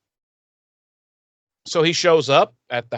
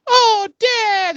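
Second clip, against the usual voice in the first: high, loud whine of complaint; whiny kids; 5 dB louder than usual. Retold or imitated. Kids groaning at their dad, high and whiny. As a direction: pitch it way up like a protesting kid. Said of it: "they're like".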